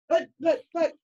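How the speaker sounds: flustered, sputtering mock protest; Austin. Player imitating the poor DM whose party avoids the fight, sputtering. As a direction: stammer it out like a thwarted game master. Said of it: "poor Austin being the D M is like".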